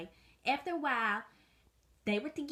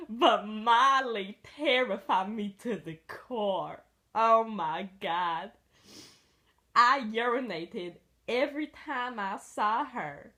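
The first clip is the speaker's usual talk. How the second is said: dramatic slow telling; slower than usual. Acted. Mock-dramatic terror retelling.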